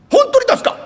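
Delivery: high, loud disbelieving question; can't believe it; a seller stunned by the buyer's offer; astonished. Acted.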